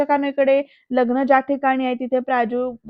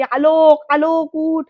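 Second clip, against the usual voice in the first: loud higher shout of his name; shouting loudly; 4 semitones higher, 5 dB louder, much slower than usual. Retold or imitated. Ambika shouting at sleeping Alok, loud urgent yell. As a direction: yell it out to rouse him. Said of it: "अरे जोरजोरात ओरडते".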